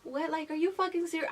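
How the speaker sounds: high-pitched disbelief; incredulous. Retold or imitated. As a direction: react in disbelief to shocking news. Acted disbelief at surgery news.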